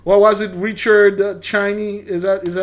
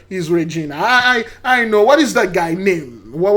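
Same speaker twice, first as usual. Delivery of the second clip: ordinary delivery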